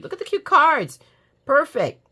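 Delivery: high, loud burst of delight; delighted exclamation. Real, not acted. Delighted by the cute cards.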